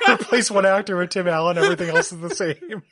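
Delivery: talking through laughter; words bubbling with laughs; laughing. Real, not acted. Cracking up at Tim Allen joke.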